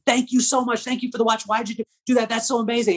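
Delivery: high, gushing thank-you; excited thanks. Retold or imitated. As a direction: thank him with rushing excitement.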